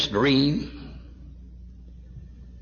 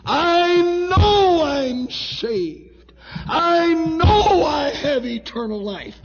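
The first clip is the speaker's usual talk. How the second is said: high, drawn-out, impassioned pulpit cry; full-force preaching; 6 dB louder than usual. Real, not acted. Preacher testifying to precious hearts of his salvation, crying it out. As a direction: build from raised declaration to sustained shouted testimony.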